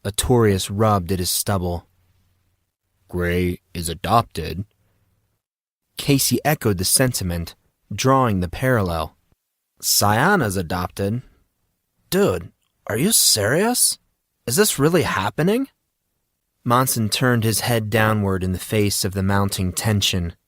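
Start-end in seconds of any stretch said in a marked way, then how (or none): 3.1-4.7 low, slow, disbelieving; Artorias, stunned. Acted. Artorias breaks the news slowly, low and stunned. 9.8-15.7 rising, incredulous pitch; can't believe his ears; Casey, shocked. Acted. Casey reacts to the adoption news, rising and incredulous.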